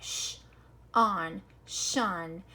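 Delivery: voiceless "sh", then "on", then blended "shun"; sounding out. Shown as a demonstration. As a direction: build "shun" from separate "sh" and "on". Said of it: "to make the sh sound".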